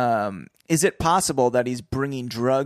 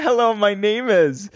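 higher, louder put-on hello; mock villain greeting; 9 semitones higher, 5 dB louder than usual. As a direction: ham up the greeting. Repeating the bit.

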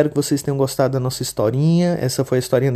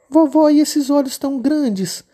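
put-on much higher, childlike little girl voice; 13 semitones higher, 5 dB louder, slower than usual. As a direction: play the innocent little girl. Menina pergunta. (menininha de voz fininha e aguda)

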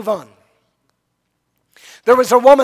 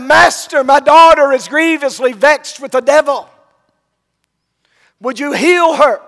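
loud, raised plea to the Master; urgent retold cry, voice lifted; 3 semitones higher, 6 dB louder than usual. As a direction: imitate a woman calling out for help. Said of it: "She cries out".

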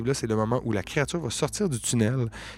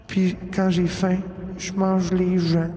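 slow, higher, eerie monster talk; menacing creature voice; 7 semitones higher, slower than usual. Acted. The game master voices the monster threatening the party, slow and higher. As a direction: let the beast savour its threat, slowly. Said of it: "Ça continue à dire comme".

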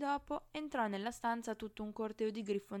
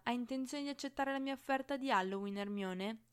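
ordinary delivery, nothing marked

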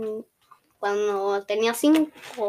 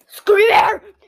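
shrieking scream; 12 semitones higher, 15 dB louder than usual. Pretend. Jump scare screamer cry.

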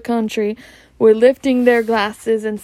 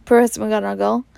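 usual speaking voice

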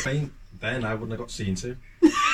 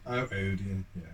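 ordinary talk

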